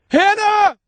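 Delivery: very high, loud scream of her name. Acted. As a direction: scream after her as she vanishes. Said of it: "he screamed".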